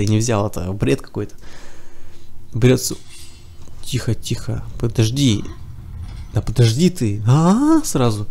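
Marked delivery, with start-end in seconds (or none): none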